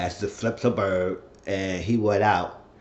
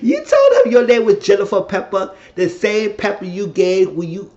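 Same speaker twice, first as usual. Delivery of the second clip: loud, high exclaiming; scandalized excitement; 10 semitones higher, 7 dB louder than usual. Real, not acted. Viewer piecing together the false name, excited disbelief. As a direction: exclaim it in gleeful disbelief, pitched up. Excited reaction to plot reveal.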